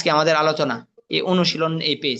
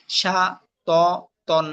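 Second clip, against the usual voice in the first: সা, ত, তন each on its own; broken syllables; much slower than usual. Shown as a demonstration. Teacher drilling learners in broken pronunciation, syllable by syllable. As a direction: pause after each piece of সা, ত, তন. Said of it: "ভাঙ্গা উচ্চারণ করবেন একটা একটা করে".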